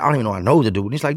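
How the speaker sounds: plain reading voice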